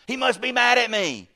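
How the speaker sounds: high, loud, worked-up wail; mock distressed outcry. Preacher acting out guilt that God is mad, loud mock distress. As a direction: cry it out as a panicked self-accuser. Voices a guilty self-accuser.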